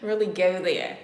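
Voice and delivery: sudden loud outburst; loud burst. Real, not acted. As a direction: blurt it out loud. Burst after laugh.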